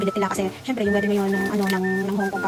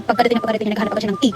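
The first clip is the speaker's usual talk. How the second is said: ordinary talk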